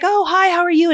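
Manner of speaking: bright high greeting; mock-cheerful doctor hello; cheery doctor voice. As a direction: pitch it up, chirpy and friendly. Anesthesiologist voiced in story.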